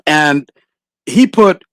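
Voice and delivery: usual speaking voice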